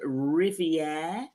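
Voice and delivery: French title in a careful French accent. Shown as a demonstration. Host shows off the series title, in best French accent. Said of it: "My best French accent".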